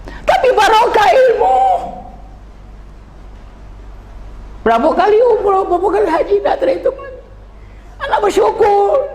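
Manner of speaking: impassioned cry. Real, not acted. Preacher cries out gratitude.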